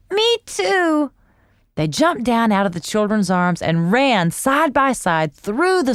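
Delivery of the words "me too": high little cat. A narrator's reading. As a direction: agree happily. Cat agreeing.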